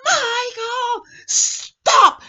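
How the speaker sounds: high-pitched yell of alarm; stretched, piercing mock shout. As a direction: act out husband hollering down the street. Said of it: "first time I ever heard him raise his voice".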